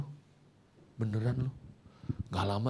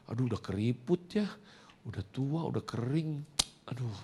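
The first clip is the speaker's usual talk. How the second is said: soft, regretful murmur; pained tenderness; 9 dB quieter than usual. Retold or imitated. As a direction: murmur it softly, aching at the sight. Murmurs over father's aged hand.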